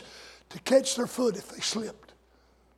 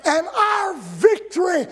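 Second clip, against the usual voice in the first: full-force preaching; 6 semitones higher, slower than usual. Acted. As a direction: preach it out with triumph.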